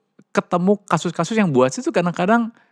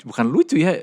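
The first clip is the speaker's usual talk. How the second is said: ordinary talk, nothing marked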